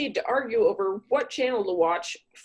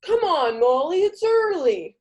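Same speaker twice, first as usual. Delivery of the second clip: higher, louder whining plea; whiny pleading date; 6 semitones higher, 6 dB louder than usual. Acted. Pushy date begging Molly at the door, higher whiny plea. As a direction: nag her for a kiss. Accountant pushing for kiss.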